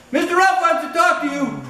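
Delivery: mock southern motel clerk voice, barely holding back a laugh; southern accent. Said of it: "So I try to use a southern ac-, accent".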